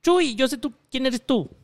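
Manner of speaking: clown voice. Retold or imitated.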